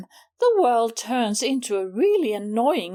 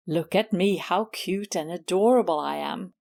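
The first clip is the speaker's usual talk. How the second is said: a lower, preening show-off cat voice; lightly voiced smug cat admiring herself; vain rival cat; 8 semitones lower than usual. Susie voicing Miss Maggie bragging in the garden, lower and smug.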